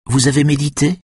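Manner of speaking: lightly voiced solemn Lydia. Lydia asks the family.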